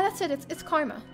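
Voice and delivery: usual speaking voice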